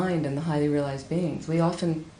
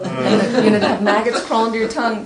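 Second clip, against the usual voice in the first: higher, louder, laughing through words; 5 semitones higher, 7 dB louder than usual. Real, not acted. Teacher telling the students, laughing. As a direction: laugh at the gross idea.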